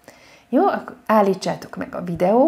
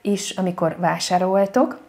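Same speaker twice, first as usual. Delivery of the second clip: normal talking voice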